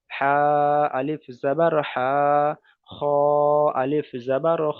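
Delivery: আলিফ জাবার বা recited slowly, বা held long; slow recitation drill. Shown as a demonstration. Teacher reciting the Qaida lesson slowly. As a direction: chant each syllable slowly. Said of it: "কেমন করে পড়বো".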